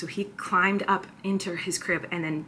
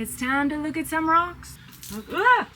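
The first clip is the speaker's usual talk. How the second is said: excited; 9 semitones higher, 3 dB louder than usual. Real, not acted. Excited to look at polished rocks.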